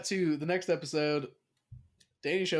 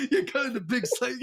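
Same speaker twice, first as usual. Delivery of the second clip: higher, laughing through the words; laughing quote; 10 semitones higher, 5 dB louder, faster than usual. Real, not acted. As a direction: giggle it out, echoing the joke. Laughing while repeating friend's joke.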